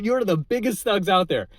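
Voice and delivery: ordinary delivery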